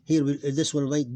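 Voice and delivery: ordinary delivery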